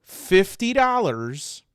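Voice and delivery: slow, loud punchline on Fifty dollars; dramatic reveal. Shown as a demonstration. Host builds up the price for viewers, slow loud reveal of Fifty dollars. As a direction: drag out Fifty dollars slowly and loudly as a big reveal. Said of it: "All right, ready, everyone?".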